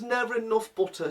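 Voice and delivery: high mock grumble; mimicking mum. Mum grumbling over the scone, high mock grumble. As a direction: grumble about the butter like mum. Son mimics mum's complaint.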